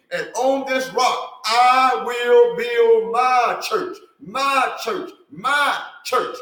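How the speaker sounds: each word of build my church drawn out slowly; slow emphatic preaching. Real, not acted. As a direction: hammer my church home, slowly. Preacher quoting Jesus, building emphasis.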